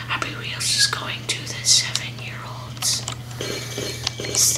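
conspiratorial whisper. Real, not acted. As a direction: whisper it like gossip. Mock conspiratorial aside.

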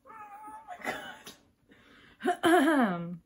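high squeak then loud burst; overwhelmed squeal. Real, not acted. Overwhelmed fangirling.

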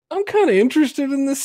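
ordinary talk

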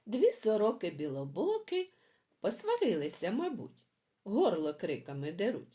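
put-on lively sing-song poem reading for kids; reading poems to children. A narrator's reading. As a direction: read it like a bedtime rhyme for little ones. Reads children's poems aloud.

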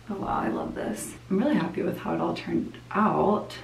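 usual speaking voice

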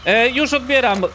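loud, high-pitched call. Pretend. Player calling to a ringing phone, impatient shout. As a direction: shout that you are on your way.

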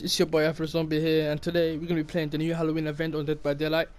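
loud, bright youtuber greeting; upbeat intro. Acted. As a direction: hype up the viewers.

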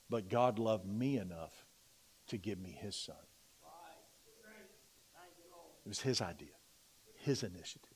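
quiet, broken, heartfelt confession; tearful hush. Real, not acted. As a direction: fight back tears as you speak. And I began to weep.

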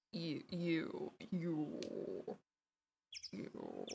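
very low and quiet, testing; lowest possible voice. Shown as a demonstration. Testing how low the voice goes, very low pitch. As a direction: sink to the bottom of your range. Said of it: "how low can I get my voice".

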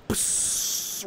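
unvoiced psst hiss like a spray can. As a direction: hiss through the teeth like a spray. Mimicking cigarette burn noise.

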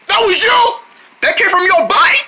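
can't believe it; shocked. Pretend. Drone character asking if the noise came from Crowd, shocked.

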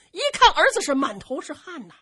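ordinary delivery, nothing marked

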